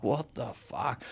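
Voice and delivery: retold quiet exasperation; exasperated. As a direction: curse under your breath at the wrong turn. Retells despair at wrong turns.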